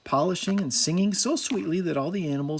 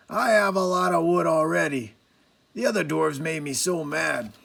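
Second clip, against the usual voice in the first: grumbling dwarf; 2 semitones higher than usual. A narrator's reading. Angry grumbling.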